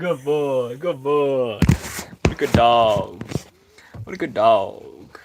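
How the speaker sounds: loud, delighted dog praise; praising a dog. Real, not acted. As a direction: praise the dog after its big howl. Praising dogs after howling.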